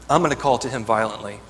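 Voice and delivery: I'm hit hardest, the rest of the line steady; stress on I'm. Acted. Preacher stressing I'm to the congregation, emphatic. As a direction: lean on the word I'm.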